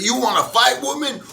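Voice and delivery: high, loud mock challenge; husband threatening. Husband daring his wife to fight, high mock challenge. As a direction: taunt her in a shrill put-on voice. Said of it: "He's like".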